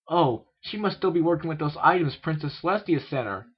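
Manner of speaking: put-on high, loud young voice lifting; brightening. A narrator's reading. Filly working out why the door is locked, brightening. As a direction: cheer up as the explanation dawns. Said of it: "she said, brightening".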